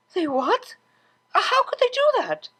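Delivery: high, gasping disbelief; shocked Octavia. A narrator's reading. Octavia hearing Vinyl's story, high and shocked. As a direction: voice climbs in shock.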